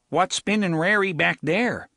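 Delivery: put-on high, loud Nordite picket accent, thick and foreign; a young Nordite guard's accent; strong in-world accent. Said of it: "speaking with a strong in-world accent".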